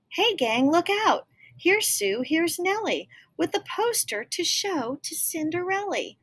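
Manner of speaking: high, eager alert to the gang; excited calling out. Acted. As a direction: call the gang over.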